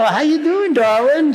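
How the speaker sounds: mock Southern charm for his wife; Southern accent put on lightly to greet his wife in the kitchen. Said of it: "talking in a Southern accent".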